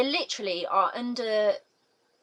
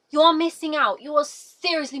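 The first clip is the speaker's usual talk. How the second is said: emphatic urging; 7 semitones higher, 7 dB louder than usual. Real, not acted. Urging viewers who haven't read it.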